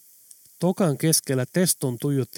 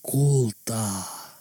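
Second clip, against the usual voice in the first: ghostly whisper; 3 semitones lower than usual. Acted. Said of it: "Teston kuuli kuiskauksen selkänsä takaa".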